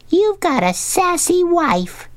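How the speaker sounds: very high, slow tiny-fish voice; squeaky little old remora; Clara. A narrator's reading. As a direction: pipe up slowly, far above normal pitch. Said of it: "apparently a quite old remora".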